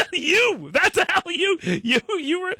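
high, loud laughing jab; giggling you, you were; laughing mock accusation. Real, not acted. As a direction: laugh it out while pointing back at her. Mocking Candace's own words.

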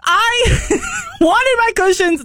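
high, slow half-laughing wail; mock-tearful laughing moan; laughing whine. Real, not acted. Recalling store meltdown over cushions.

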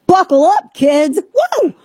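high, bright call to buckle up; excited hype. Real, not acted. Host hyping listeners before the story. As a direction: rev up the listeners.

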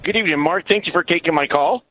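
ordinary delivery

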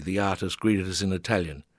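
plain reading voice

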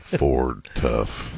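low, gruff sick-voiced ad read; truck commercial voice. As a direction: sell the truck with a croaky voice. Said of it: "We need all truck commercials read by you".

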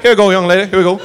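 folksy Southern cop, lightly voiced; Andy Griffith. Cop leading the drunk woman out, folksy. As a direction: guide her along like a dance partner. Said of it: "Like a little do-si-do".

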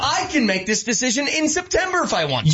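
high-pitched defiant boast, acted out; LeBron James, defiant. Star player telling the commissioner he decides, defiant. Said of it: "And, and LeBron says".

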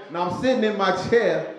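ordinary talk

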